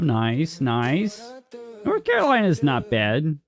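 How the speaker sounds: ordinary talk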